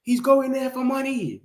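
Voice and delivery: high-pitched parody of complaining supporters; mocking rival fans. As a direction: chant the complaint in a silly high voice. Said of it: "when Newcastle fans are coming out there".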